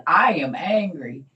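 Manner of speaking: loud, slow declaration of anger; retold angry cry. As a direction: announce your anger loudly and slowly. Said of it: "I woke up saying".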